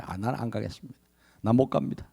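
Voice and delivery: very soft, reluctant no; muttered refusal. Acted. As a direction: mumble that you won't go. Moses voiced refusing. (하나님의 부르심을 조용히 거절하는 모세)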